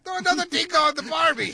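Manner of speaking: high, loud mock Aussie; hammy outback Australian voice; Australian accent.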